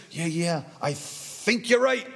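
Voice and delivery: lightly voiced old man, slow and hesitant, rising at the end; soft start, then slowly pitch up into agreement; befuddled old man. Befuddled old man humoring the committee, lightly acted.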